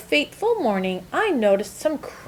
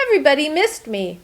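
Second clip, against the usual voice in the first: ordinary delivery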